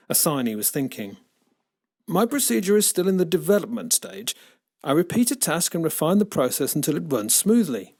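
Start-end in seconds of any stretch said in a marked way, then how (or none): 2.1-8.0 robot butler voice. A narrator's reading.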